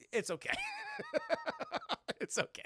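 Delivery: usual speaking voice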